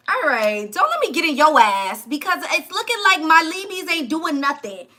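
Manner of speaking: ordinary talk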